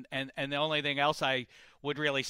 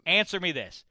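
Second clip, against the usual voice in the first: ordinary delivery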